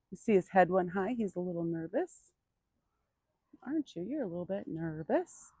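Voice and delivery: soft, reassuring murmur; soothing. Real, not acted. Trainer reassuring her nervous horse, soft and calm. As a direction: settle him down gently and quietly.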